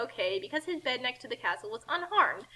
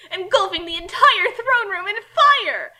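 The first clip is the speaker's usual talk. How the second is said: dramatic build; 7 semitones higher, 10 dB louder, slower than usual. A narrator's reading. Narrating huge blaze.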